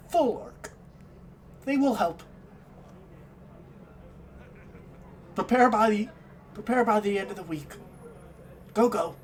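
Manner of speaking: Kenku retort. Acted. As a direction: snap back as the Kenku, high and quick. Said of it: "The Kenku immediately retorts".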